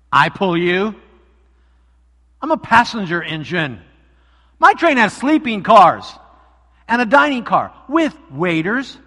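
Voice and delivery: loud, haughty passenger engine; snooty snorting engine. Acted. Proud engine refusing to help the stranded toys, loud and haughty. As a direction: look down your nose and snort. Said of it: "the shiny new engine snorted".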